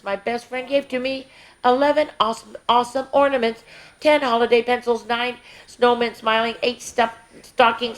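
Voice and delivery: lively sing-song counting rhyme; reading to children. Acted. Miss Tasha reading a Christmas counting book to kids, sing-song. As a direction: read it out brightly, verse by verse for the little ones.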